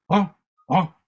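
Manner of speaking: mildly startled, loud little interjection; startled out of meditation, looking around. Retold or imitated. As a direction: act out a brief start of alarm. Sage startled during meditation.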